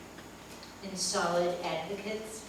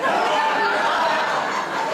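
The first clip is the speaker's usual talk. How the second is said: louder breathy laughter; 11 dB louder than usual. Real, not acted. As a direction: crack up at the toupee. Laughs at toupee story.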